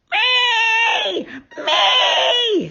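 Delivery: very high screeching owl cry. A narrator's reading. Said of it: "screeched the owl".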